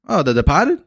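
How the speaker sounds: Departed as Depahted, r dropped, Boston style; Boston accent. Retold or imitated. Jasmine naming the movie in Boston accent. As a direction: sister answers in a Boston accent. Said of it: "That's how you gotta say it".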